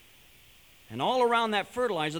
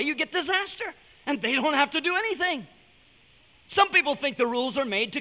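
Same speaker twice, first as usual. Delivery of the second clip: raised, fervent preaching voice; 7 semitones higher, 4 dB louder than usual. Acted. Preacher urging the congregation, raised and fervent. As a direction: drive the moral home from the pulpit.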